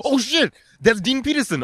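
high-pitched burst of surprise; amazed exclamation. Real, not acted. Host hearing the guest's name, amazed. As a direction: react as if stunned by the name.

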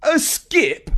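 high, indignant disbelief; outraged. Acted. Jeff hearing Cousin Jenny's skip story, outraged high squeak. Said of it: "even Jeff was outraged".

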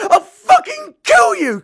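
very high, loud, slowed scream; murderous scream. Acted. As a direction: scream the threat with everything. Scream continues in fight.

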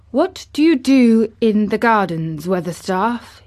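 put-on lordly boy questioning his gardener; Colin. A narrator's reading. Colin questions old gardener Weatherstaff, lordly.

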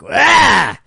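loud high primal shout; animalistic cry. Pretend. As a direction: let out a raw animal yell.

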